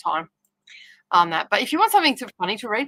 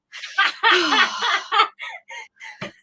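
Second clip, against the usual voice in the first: startled laughing gasp; 13 semitones higher than usual. Real, not acted. Daughter startled her on camera.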